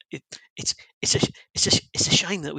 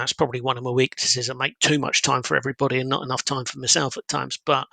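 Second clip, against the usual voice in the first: ordinary talk, nothing marked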